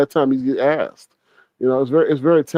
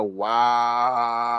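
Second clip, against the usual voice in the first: stunned wow; 3 semitones lower, 7 dB quieter than usual. Acted. Acts out awed reaction.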